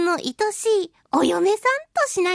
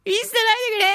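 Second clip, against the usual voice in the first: a lighter, higher mock plea; mock clingy plea; 8 semitones higher, much faster than usual. Clinging husband begging his wives again, half-joking. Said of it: "すがる系でございますからね".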